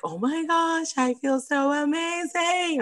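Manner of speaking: hammed-up giddy relief; elated author. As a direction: client thrilled after launching her book. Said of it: "now she was like".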